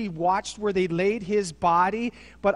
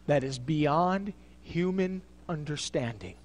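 ordinary talk, nothing marked

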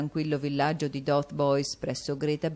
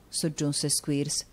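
usual speaking voice

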